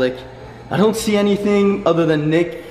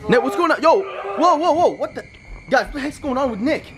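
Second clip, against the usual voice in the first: high, panicked cries at a friend; alarmed shouts, pitch shooting up; freaked-out calls to Nick; panicked yelling; 10 semitones higher than usual. Acted. Friend suddenly acting strange.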